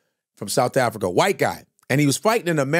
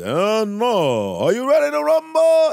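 Michael Buffer impression; 8 semitones higher, slower than usual. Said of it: "And Michael Buffer's like".

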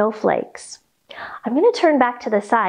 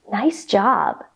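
ordinary talk

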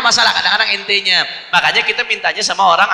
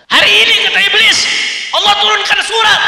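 high, loud proclamation; acted loud cry; 12 semitones higher, 4 dB louder than usual. Preacher voicing Iblis telling minions of the surah, high acted cry. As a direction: cry it out as the devil's boss. Acting Iblis's anguished announcement.

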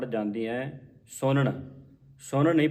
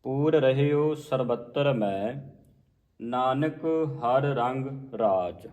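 slow, with ਮੈਂ un-nasalised; correct recitation; much slower than usual. Shown as a demonstration. Teacher recites the corrected verse slowly. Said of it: "ਬਿੰਦੀ ਤੋਂ ਰਹਿਤ ਪੜ੍ਹਨਾ ਹੈ".

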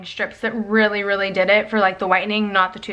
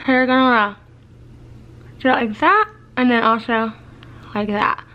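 fingers in mouth; talking while flossing. Real, not acted. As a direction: floss between front teeth. Seen 0: Floss and fingers between front teeth while speaking; absent in references.

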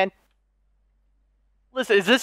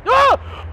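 startled yelp; 13 semitones higher than usual. Real, not acted. Startled gamer yelping at a jump scare.

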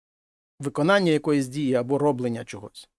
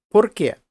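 "Por qué?" higher and louder, falling; clear model word; 4 semitones higher, 5 dB louder than usual. Shown as a demonstration. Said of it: "teacher models the word".

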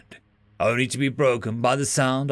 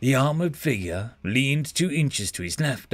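ordinary delivery, nothing marked

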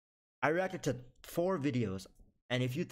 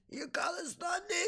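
much higher, slow strained cry-shout; mock dying shout; slower than usual. Imitating a commenter's final words, high slow cry. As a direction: wail it out as a last dying word. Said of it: "the last words they would say is".

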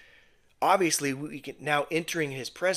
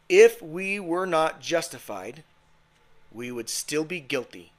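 ordinary talk, nothing marked

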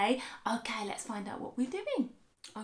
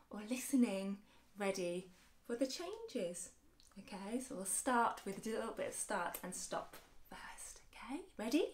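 whisper; 8 dB quieter than usual. Acted. Hushed build-up before game.

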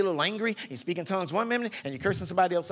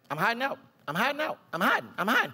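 mock hiding woman; 7 semitones higher, faster than usual. Healed woman retreating into hiding.

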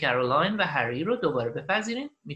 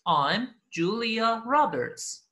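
very slow, raised teaching voice; listen-and-repeat model; 5 semitones higher, 4 dB louder, much slower than usual. Shown as a demonstration. Teacher repeats the recording for learners, slow model. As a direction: space the words out for learners. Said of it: "and repeat و قرار شما تکرار کنید".

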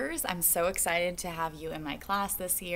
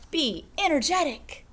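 slow, sing-song picture book reading; reading to children; 4 semitones higher, slower than usual. Acted. Teacher reading a picture book to second graders, slow sing-song. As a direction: stretch each line out for the kids.

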